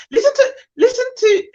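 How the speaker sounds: high, laughing listen to; exasperated laugh. Real, not acted. Outraged at the email, a high laughing outburst. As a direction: laugh through the disbelief.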